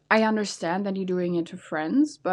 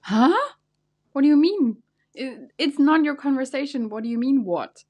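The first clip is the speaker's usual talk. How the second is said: higher, louder outraged questions; indignant; 5 semitones higher, 5 dB louder than usual. Acted. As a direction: react outraged at the intrusion. Outraged reaction to intruder.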